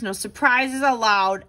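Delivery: ordinary delivery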